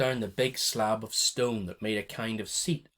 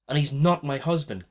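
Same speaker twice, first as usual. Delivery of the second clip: higher, louder cry; cried out; 5 semitones higher, 6 dB louder than usual. A narrator's reading. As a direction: cry it out in protest. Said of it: "cried Iman".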